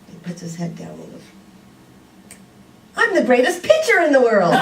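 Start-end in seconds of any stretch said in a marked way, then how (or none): 3.0-4.5 put-on laughing through the boy's words, higher and louder; can't hold the laugh at the punchline. Telling the congregation the twist, laughing.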